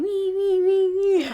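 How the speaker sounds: Monk mimicking Harold sobbing in high mock whimpers; mock whimpering. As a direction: squeak like a crybaby. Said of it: "He's like".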